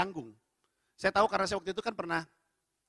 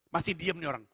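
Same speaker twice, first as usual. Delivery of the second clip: usual speaking voice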